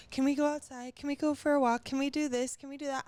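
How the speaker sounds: high, loud string of begging questions; insistent child voice, mimicked; imitated pestering kid. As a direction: nag like an excited little kid. Voicing her pestering young child.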